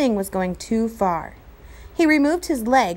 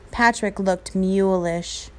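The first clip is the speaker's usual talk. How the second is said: ordinary delivery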